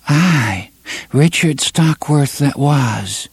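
high, slow trance answer; dreamy young woman. Acted. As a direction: answer from far away.

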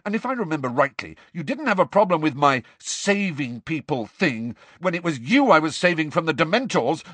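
high-pitched, heated tirade; angry shouted rant. A narrator's reading. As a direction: rant in mounting frustration. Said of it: "Harry shouted at her".

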